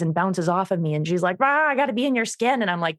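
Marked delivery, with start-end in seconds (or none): 1.4-2.6 eager dog voice. Retold or imitated. Voicing clingy stressed dog.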